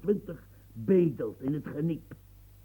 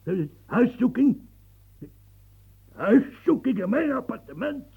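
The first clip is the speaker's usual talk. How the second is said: higher, louder, sputtering outrage; can't believe the insult; outraged disbelief; 6 semitones higher, 6 dB louder than usual. Acted. Old man to the officer about the search, outraged.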